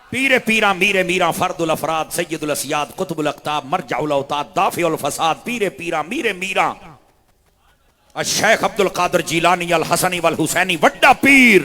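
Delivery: loud, rhythmic litany of titles; preaching at full force. Acted. Preacher extolling the saint's names to the crowd. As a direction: roll the honorifics out like a chant.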